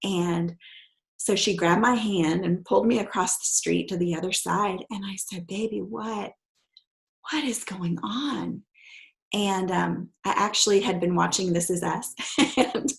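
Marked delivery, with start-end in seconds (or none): 5.5-8.6 gentle question. Retold or imitated. Mother gently asking scared daughter.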